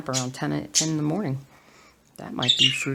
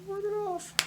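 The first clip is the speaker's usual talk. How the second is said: much higher squeaky repeat of ward it off; squeaky echo; 6 dB quieter than usual. Pretend. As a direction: squeak the phrase way up like a cartoon.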